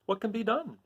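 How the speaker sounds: high, puzzled Tin Woodman; a worried tin man asking. Acted. Tin Woodman asking what to do. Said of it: "inquired the Woodman".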